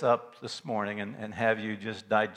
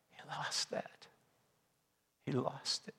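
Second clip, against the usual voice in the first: hushed, breathy whisper, much softer; whispered grief; 2 semitones higher, 12 dB quieter than usual. Acted. Preacher to congregation on the cross, hushed whisper. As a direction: let it fall to a whisper. Grief over Christ's loss.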